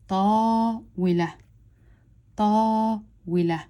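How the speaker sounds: "ta" held long, then "wi, la" run together; two-part blend. Shown as a demonstration. Teacher blending the second part of the word, two beats. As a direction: hold "ta", then blend "wi, la" quickly as one. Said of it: "I blend these two together".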